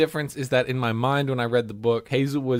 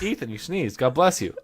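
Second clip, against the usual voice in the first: usual speaking voice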